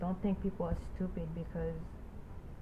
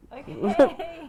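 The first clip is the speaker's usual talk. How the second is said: high, loud drawn-out reaction, half laughing; pitched up, amused disbelief; incredulous mock okay; 5 semitones higher, 9 dB louder than usual. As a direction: imitate a taken-aback response with a laugh. Mock reaction to being corrected.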